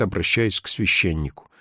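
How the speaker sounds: plain reading voice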